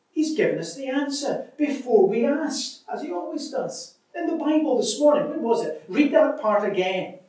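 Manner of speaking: bursting with discovery; excited. Acted. Betsy to her sister Corrie, excited. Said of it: "Betsy then exclaimed excitedly".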